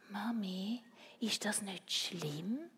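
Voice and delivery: put-on soft, slow child's question; timid question. A narrator's reading. Christoph asks Mami whether it is bad, timidly. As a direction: ask it small and unsure.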